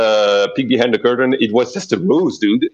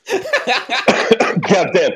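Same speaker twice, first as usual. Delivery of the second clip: laughing; 11 semitones higher than usual. Real, not acted. Laughing exclamation at player.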